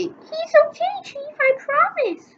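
high put-on nurse voice. Nurse reassures Chief about Dogman, high and light.

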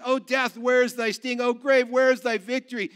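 high, loud taunt at death; spiritual trash talk. Acted. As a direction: throw the verse out like trash talk. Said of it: "Paul's doing some spiritual trash talking".

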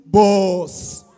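low, loud mock salute; exaggerated deep street hail; youth hailing boss. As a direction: drop the pitch and hail him loudly. Imitates youths hailing someone.

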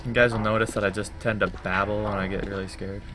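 ordinary talk, nothing marked

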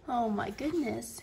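hushed whisper of delight. Real, not acted. Owner whispering fondly over her cat, hushed. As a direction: breathe it out in a whisper.

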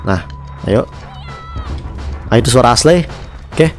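usual speaking voice

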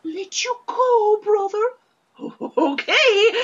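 high mock-sweet taunt; mocking sweetness. Villain mocking before throwing.

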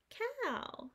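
spring the rhyme as a surprise; excited reveal. Acted. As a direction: jump way up on the answer. Punchline answer.

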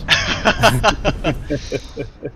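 loud delighted laughing; hearty laugh. Real, not acted. Laughing after the win.

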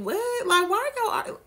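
high-pitched, incredulous reaction; baffled disbelief. Acted. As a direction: pitch it way up in exasperated bewilderment.

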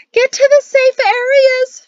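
very high, loud wailing; shrieking old woman raising the alarm; panicked wail. A narrator's reading. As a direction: wail a warning in terror. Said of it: "she began to wail".

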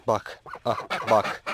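usual speaking voice

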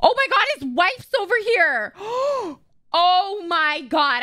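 gasping, high and loud; shocked gasp. Real, not acted. Streamer spots the wife walking in, gasping high. Gasps seeing the wife arrive.

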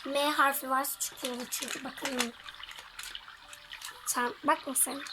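usual speaking voice

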